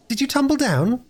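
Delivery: put-on very high-pitched child's question; sniffling little girl. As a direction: ask in a small girl's high voice. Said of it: "asked Phyllis, sniffing".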